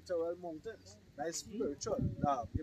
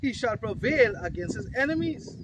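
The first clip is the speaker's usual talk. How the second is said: loud, raised-pitch declaiming; scripture proclamation; 5 semitones higher, 10 dB louder, slower than usual. Acted. Preacher quoting the battle cry to his group, loud proclamation. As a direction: proclaim it forcefully.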